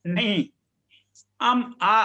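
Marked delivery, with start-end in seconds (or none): 1.4-2.0 अम, आ louder, the opening vowel isolated; emphatic teacher's demo of अम, आ; pronunciation correction. Shown as a demonstration. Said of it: "आ का उच्चारण आना चाहिए ना".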